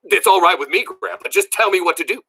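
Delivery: high, eager burst; excited blurt. A narrator's reading. Billy worked up, agreeing to grandpa's plan, blurting. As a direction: blurt it out all at once. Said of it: "I reared back and blurted".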